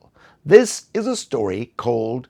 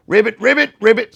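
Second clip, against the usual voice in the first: high, loud ribbits; bright loud frog ribbits; frog croaks; 7 semitones higher, 5 dB louder than usual. Pretend. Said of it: "you wanna try it? Go ahead".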